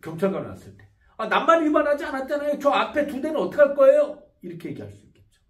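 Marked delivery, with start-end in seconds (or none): 1.2-4.2 higher, louder argumentative retort; mock indignant driver; indignant protest. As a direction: argue back at the traffic cop. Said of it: "씨피가 발달한 사람들".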